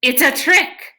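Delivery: high, loud cry; cry of alarm. A narrator's reading. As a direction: call out the trick. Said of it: "He pointed at the station wagon and cried".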